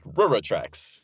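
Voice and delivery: "railroad tracks" with most of "railroad" swallowed; swallowed syllables. Shown as a demonstration. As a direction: slur "railroad" down to "ruh-ruh" before "tracks". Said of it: "lose most of the word".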